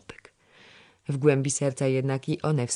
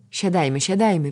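louder urging, second word higher; 5 semitones higher, 6 dB louder than usual. A narrator's reading. Diligent girl hurrying the others back to work, urging. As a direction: hurry them back, rising in insistence. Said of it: "przynaglała Kasia do roboty".

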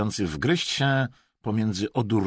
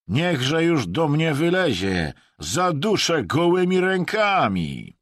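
loud, high, drawn-out threat; angry ranting; 9 semitones higher, 6 dB louder, slower than usual. A narrator's reading. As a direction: rage slowly at the absent quarry. Angry hunter rants in story. (gniewna, głośna pogróżka)

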